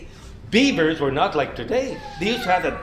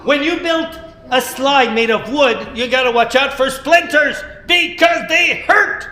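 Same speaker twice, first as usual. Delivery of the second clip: higher, louder, slow warning; emphatic warning; 7 semitones higher, 6 dB louder than usual. Acted. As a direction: stress the danger to the children. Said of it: "storyteller warns about splinters".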